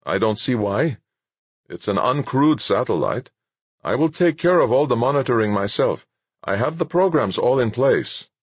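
impatient character voice, rising in pitch; insistent scientist. Acted. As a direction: argue it as a single-minded researcher. Narrator voices insistent scientist arguing.

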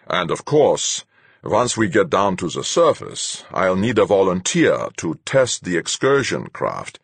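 commanding captain, cold; stern captain. A narrator's reading. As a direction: lay it out like a hard captain. Captain Fuchs' voice.

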